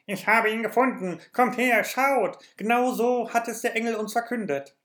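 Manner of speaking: hohe, laute Kinderstimme voller Freude; ganz aufgeregt. A narrator's reading. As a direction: ein Junge jubelt über den Fund. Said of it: "rief er ganz aufgeregt". (aufgeregt rufender Junge)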